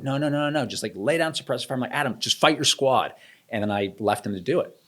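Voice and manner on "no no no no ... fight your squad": urgent order. Retold or imitated. Lieutenant giving orders under ambush fire.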